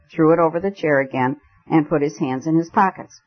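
plain reading voice

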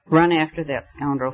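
ordinary delivery

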